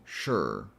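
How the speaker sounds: "sure" as SH straight into R; skipped vowel. Shown as a demonstration. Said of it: "kinda like skipping the vowel".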